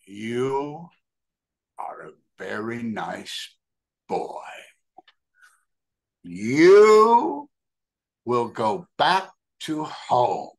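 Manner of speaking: slow, hushed guru voice, then a loud rise; solemn blessing. Retold or imitated. Guru blessing the kneeling boy, slow and hushed. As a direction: bless him slowly, hushed then swelling. Prabhupada blesses him softly.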